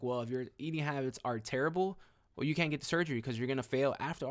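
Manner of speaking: ordinary talk, nothing marked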